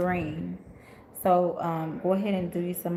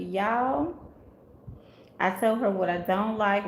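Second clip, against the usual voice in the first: usual speaking voice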